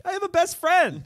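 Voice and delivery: high, loud squeal of pride; excited mock cry. Retelling two new best friends waking up, mock-excited shout. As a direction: shout it like an overjoyed kid. Waking up thrilled in retold film.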